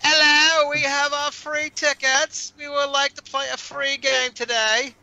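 high, put-on eager visitor; high-pitched mocking customer impression. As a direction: mimic a pest in a high singsong. Mimicking a free-ticket customer.

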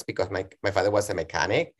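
ordinary talk, nothing marked

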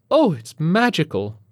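high, loud, slow wonder; awed woman. A narrator's reading. Woman in the crowd captivated by the launch, awed gushing. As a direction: gush slowly in a high voice. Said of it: "a woman says near".

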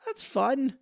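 squeaky high delighted "Ah"; mock delighted gasp of fun; amused reaction. Recalling watching the waltz gag, high delight. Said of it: "I think I went".

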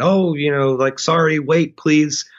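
high-pitched pleading call; mock yell. Startled tenant to the nun outside the door, mock yell. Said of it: "just trying to yell".

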